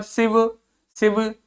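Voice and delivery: सिव said lightly, twice over; light unstressed syllable. Shown as a demonstration. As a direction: keep each सिव soft and unstressed. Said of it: "ये हल्का बोलना है".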